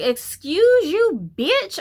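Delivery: high, slow, outraged comeback; slow, high-pitched mock offense; indignant. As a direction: drag out the words in disbelief. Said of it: "I was like".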